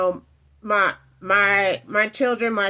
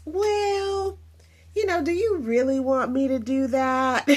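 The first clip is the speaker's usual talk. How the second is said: put-on whiny child; 4 semitones higher than usual. Child talking back to parent.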